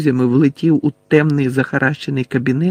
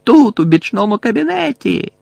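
high-pitched holler to allies; горлання; 8 semitones higher, 3 dB louder than usual. A narrator's reading. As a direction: call out the hiding place urgently. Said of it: "загорлав найближчий до Гаррі смертежер". (високий оклик до своїх)

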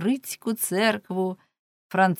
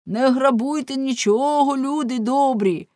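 higher, louder appeal to good people; pleading shout; 5 semitones higher, 5 dB louder than usual. Acted. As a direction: beg the crowd loudly not to loot. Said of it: "гукнув Ламе". (Ламе благально гукає до людей)

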